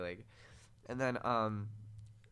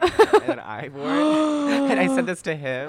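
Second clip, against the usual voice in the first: loud laughing burst; laughing loudly; 13 semitones higher, 13 dB louder than usual. Real, not acted. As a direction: laugh through the words. Laughing while telling.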